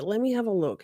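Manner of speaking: ordinary delivery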